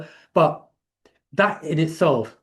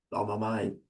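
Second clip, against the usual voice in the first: low murmur; 5 semitones lower, 10 dB quieter than usual. Real, not acted. Telling friends about okra water, a quiet stunned murmur. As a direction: lower and softer, quietly stunned.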